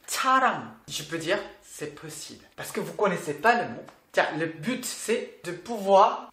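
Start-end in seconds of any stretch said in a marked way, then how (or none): 0.1-0.6 CHARANG begun with CH instead of S; misheard first consonant. Shown as a demonstration. Teacher voicing what learners might mishear, CH onset. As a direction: start CHARANG on a clear CH. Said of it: "j'ai entendu comme".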